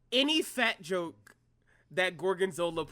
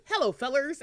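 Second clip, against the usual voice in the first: imitating greeting; 7 semitones higher, 4 dB louder than usual. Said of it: "he was like this".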